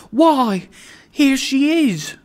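high, loud burst of delight; delighted cry. A narrator's reading. Father catching up the child, delighted cry. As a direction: exclaim it in joyful welcome. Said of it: "cried Trotty".

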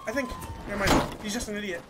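ordinary talk

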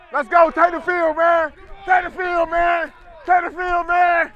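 loud rallying call; shouting. Real, not acted. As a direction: call the team out hard. Rallying team onto field.

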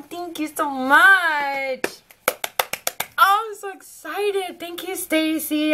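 slow, high, heartfelt thanks; tender high thank-you; grateful. Real, not acted. Thanking the gift giver.

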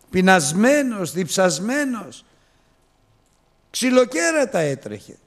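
high, loud and slow, building; impassioned preaching. Acted. Preacher telling the congregation of the father running to his son. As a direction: preach it out with fervour.

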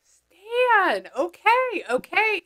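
high-pitched, drawn-out reaction; delighted recognition. Real, not acted. Medium hears the son's name, high delighted recognition. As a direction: say it high, as it clicks.

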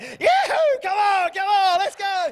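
loud, very high cattle-drive yell; cowboy whooping. Retold or imitated. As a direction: whoop the herd along like a movie cowboy. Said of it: "what they do in the cowboy movies".

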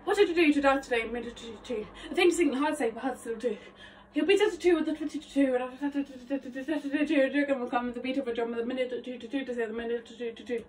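rapid-fire rhythmic patter; fast chanted articulation drill; sped-up tongue twister. Shown as a demonstration. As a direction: race through it without stopping. Repeats tongue twister faster.

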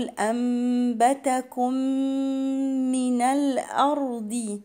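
"أنبتكم من" joined on one long hummed m; merged mims. Shown as a demonstration. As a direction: hold the doubled م between "أنبتكم" and "من", chanting. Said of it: "إدغام مثلين صغير".

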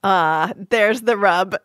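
ordinary talk

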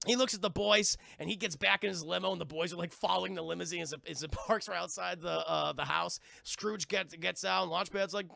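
ordinary delivery

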